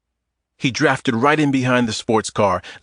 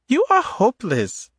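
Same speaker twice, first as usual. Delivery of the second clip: put-on higher woman's voice with a light Eastern European accent; 14 semitones higher than usual. Adita teasing Tom in the grocery aisle, Eastern European accent. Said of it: "In a light Eastern European accent".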